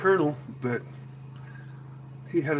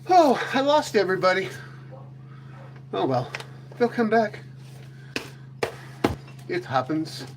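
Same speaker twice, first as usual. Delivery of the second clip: ordinary talk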